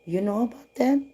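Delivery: high, rising astonished question; re-enacted amazement, pitch climbing; retold surprise. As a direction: imitate her taken-aback question. Retelling the lady's surprised question.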